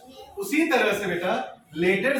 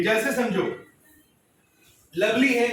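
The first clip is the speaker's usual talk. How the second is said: usual speaking voice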